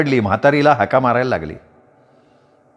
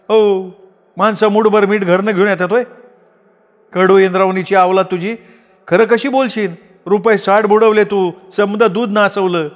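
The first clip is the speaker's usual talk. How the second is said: higher, shrilling woman's counter-accusation; Dhabi accusing; 7 semitones higher than usual. A narrator's reading. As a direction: throw it back at her. Dhabi taunts Radhi. (आरोप करणारी ढबी)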